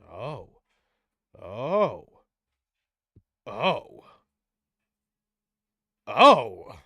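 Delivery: each oh grows from shock to rage; reading damning pages, shock rising to anger. Acted. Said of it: "it goes from shock to disgust to anger".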